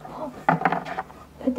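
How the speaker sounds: ordinary talk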